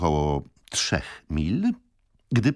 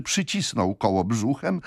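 usual speaking voice

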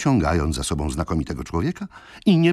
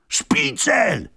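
much higher, loud yelling crowd; 3 dB louder than usual. Acted. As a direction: bellow it as an angry mob. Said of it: "wrzasnął tłum". (wysoki, głośny wrzask tłumu)

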